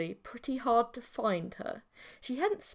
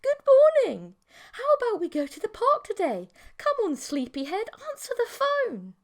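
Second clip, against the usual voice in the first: high, bright-loud sing-song call, much higher and louder; sing-song girl voice; 12 semitones higher, 7 dB louder than usual. A narrator's reading. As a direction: chirp it into the phone like an eager girlfriend. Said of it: "a familiar sing-song voice".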